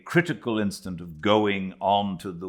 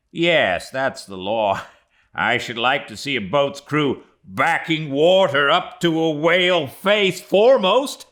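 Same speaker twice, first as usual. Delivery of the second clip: very high, loud, gleeful sailor's boast; Flask, cocky sailor; 9 semitones higher, 8 dB louder than usual. Acted. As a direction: crow about it with a laugh.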